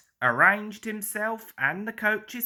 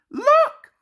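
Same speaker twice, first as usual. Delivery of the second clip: excited; 9 dB louder than usual. A narrator's reading. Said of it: "said Thomas excitedly".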